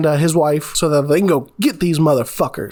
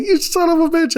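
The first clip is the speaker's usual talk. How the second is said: furious pained curse; 12 semitones higher than usual. Retold or imitated. Patty stabbed with duck fork.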